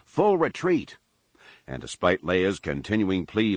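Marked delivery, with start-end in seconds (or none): 0.1-1.0 high-pitched cried command; urgent cry. A narrator's reading. Han ordering the fleet away on all channels, cried. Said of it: "Han cried through all channels".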